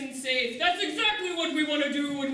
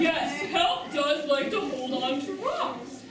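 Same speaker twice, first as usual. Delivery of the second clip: usual speaking voice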